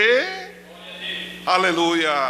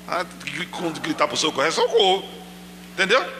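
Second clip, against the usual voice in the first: ordinary talk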